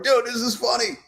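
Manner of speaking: mock amused friend. His friends reading his sketch, a high mock amused buddy voice. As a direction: squeak it out like an impressed pal.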